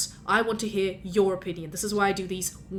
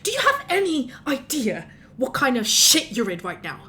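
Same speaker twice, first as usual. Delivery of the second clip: raised, exasperated scolding; angry scolding; 2 semitones higher than usual. Acted. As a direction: berate the witness, barely holding it in. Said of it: "I'm sorry for shouting at you".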